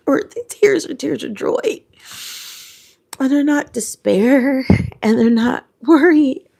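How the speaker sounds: real tears of joy, voice lifted; crying with joy. Real, not acted. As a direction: speak through happy tears. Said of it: "I'm crying again".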